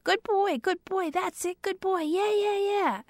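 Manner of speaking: very high, excited dog praise; high-pitched praise. Shown as a demonstration. Trainer praising her puppy for fetching, very high-pitched and gushing. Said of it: "in a very high-pitched voice".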